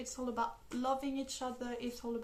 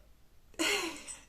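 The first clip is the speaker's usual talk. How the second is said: bright giddy laugh; happy laugh; 6 semitones higher than usual. Real, not acted. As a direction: laugh with delight. Laughs about loving scene.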